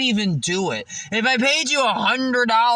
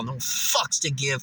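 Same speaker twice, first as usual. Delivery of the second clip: ordinary delivery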